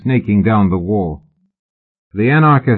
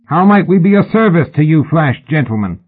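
high raised hail; calling up; 8 semitones higher, 4 dB louder than usual. A narrator's reading. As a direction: call up with showy courtesy. Calling up to men on wall.

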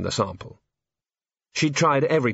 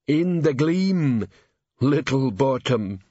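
slow troll voice; 3 semitones higher, much slower than usual. Acted. Troll answers.

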